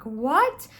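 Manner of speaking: mock-incredulous outburst, voice jumping up; startled parents' reaction. Parents hearing light language from their visiting child, mock bafflement. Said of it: "and they're like".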